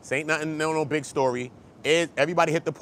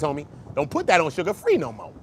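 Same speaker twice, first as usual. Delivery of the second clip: ordinary delivery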